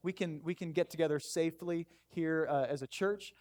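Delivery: hushed, informal thanks to God; sincere prayer. Real, not acted. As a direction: lead the room gently in prayer. Leads congregation in prayer.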